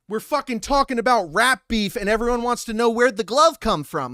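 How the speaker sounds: usual speaking voice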